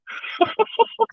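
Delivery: short high unvoiced laugh; breathy giggle. Real, not acted. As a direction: giggle at herself under the breath. Laughs at her own scolding.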